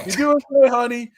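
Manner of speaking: high, loud sing-out to a sweetheart; mock calling out. Peter calling down from the rafter to MJ, mock cheerful. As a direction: call down sweetly from up high, hammed-up.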